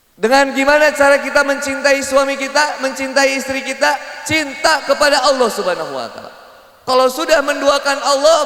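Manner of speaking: loud, high sermon delivery; impassioned preaching. Acted. Preacher exhorting couples to love Allah first, impassioned. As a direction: drive the point home to the congregation.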